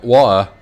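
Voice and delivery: "water" with a glottal stop for the T; "water" said as wa'er, British glottal stop; dropped T. Shown as a demonstration. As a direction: swallow the T in "water", British style. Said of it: "not pronouncing the T's in the middle of words".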